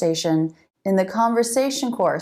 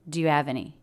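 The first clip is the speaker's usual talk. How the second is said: usual speaking voice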